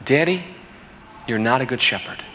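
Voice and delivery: quiet, sober echo in his own voice; subdued repeat. Retold or imitated. Father recalling his daughter's words, quiet and sober. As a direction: repeat the accusation softly, letting it sink in.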